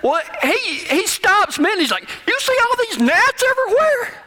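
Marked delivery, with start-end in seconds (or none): 2.3-4.1 high-pitched incredulous exclamation; mock astonishment. Cowboy buddy stops work to notice gnats, astonished. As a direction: act out a buddy's amazed question.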